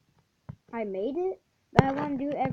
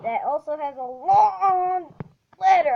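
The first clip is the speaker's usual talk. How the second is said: louder and higher as the jump lands, drawn out slowly; tense rising cry; 5 semitones higher, 10 dB louder than usual. Real, not acted. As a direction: strain through the jump, voice climbing. Jump tension while playing.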